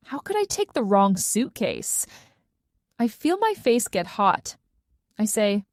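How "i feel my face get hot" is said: usual speaking voice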